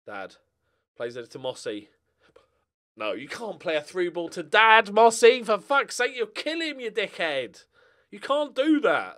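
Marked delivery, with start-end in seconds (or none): 3.0-9.2 louder and higher, telling the player off; exasperated rant. Real, not acted. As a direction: scold the striker for a stupid pass. Ranting at player's through ball.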